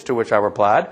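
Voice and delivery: usual speaking voice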